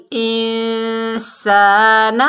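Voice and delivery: انسان with a hidden nasal ن and a drawn-out ا; ikhfa nasal. Shown as a demonstration. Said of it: "نون ساکن". (انسان میں اخفا کے ساتھ)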